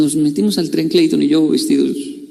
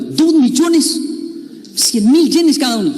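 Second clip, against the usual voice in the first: shocked; 8 semitones higher, slower than usual. Retold or imitated. Friend stunned by the price of the tickets, high and shocked.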